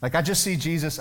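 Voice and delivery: usual speaking voice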